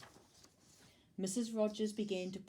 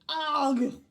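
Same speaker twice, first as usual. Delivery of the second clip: loud, high cry of disgust; loud "ugh" of revulsion; disgusted scream; 7 semitones higher, 11 dB louder than usual. A narrator's reading. Missus Ralph bites into the cake, screaming in disgust. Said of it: "she screamed".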